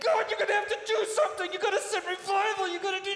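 high, loud, fast demanding plea; desperate striving. Retold or imitated. Striving believer demanding revival, loud high plea. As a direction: rush and push, insisting God send revival. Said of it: "this deep striving of like".

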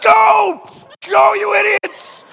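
very high loud mock yell; Peter yelling. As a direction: scream at the crew to row. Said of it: "Peter's like".

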